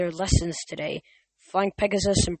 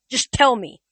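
higher, louder insistent demand; louder, insistent, pitched up; 6 semitones higher, 8 dB louder than usual. A narrator's reading. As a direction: push hard, no patience left. Nico insists impatiently.

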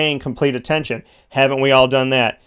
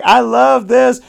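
delighted; 8 semitones higher, 4 dB louder, slower than usual. Retold or imitated. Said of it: "I'm just like".

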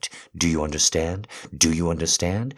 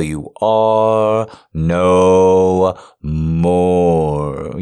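drawn out; 6 dB louder, much slower than usual. Acted. Drawn out menacing finish.

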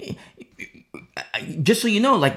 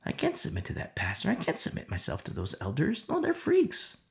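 Chloe's quiet protest; 8 dB quieter than usual. Retold or imitated. Chloe refusing the Corinth pastors, softer. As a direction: say it softly as a determined refusal. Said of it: "it was Chloe who knew".

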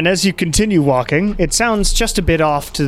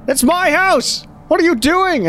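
high-pitched outraged yell; 14 semitones higher than usual. Acted. Man locked out of his own house, yelling. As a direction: shout it high, like someone locked out. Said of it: "and he's yelling".

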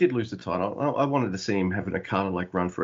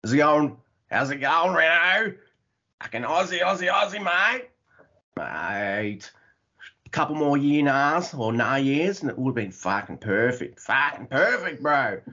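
broadest, trashiest Australian accent; exaggerated Aussie bogan with rising, stretched words; 4 semitones higher than usual. Shown as a demonstration. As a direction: lay the bogan on thick.

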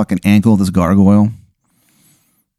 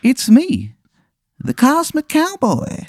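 high, far-off otherworldly drawl; cosmic cowboy voice; 3 dB quieter than usual. Acted. The Cosmic Cowboy hailing Sawyer, high and ethereal. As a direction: call down from the stars, slow and high.

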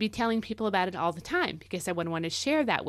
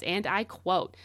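normal talking voice

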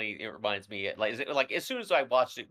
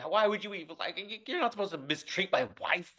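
lightly voiced indignant vampire husband, higher; mock-offended Laszlo, pitched up; a hint of Laszlo; 8 semitones higher than usual. Retelling the show, Laszlo defends his wife with mild mock outrage. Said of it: "Laszlo's even like".

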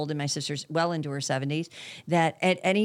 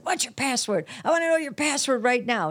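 angry dad; 9 semitones higher than usual. Retold or imitated. Retold Kevin Hart dad demanding.

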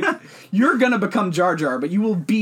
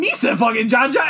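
loud, higher mock Gungan voice; Jar Jar Binks; 5 semitones higher, 4 dB louder than usual. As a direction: shout it like an obnoxious alien sidekick. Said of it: "the fact that he's going around".

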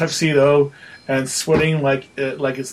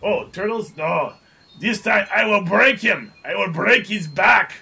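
put-on higher, boastful brute with a thick accent; barbarian; 6 semitones higher than usual. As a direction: growl out threats like a proud barbarian.

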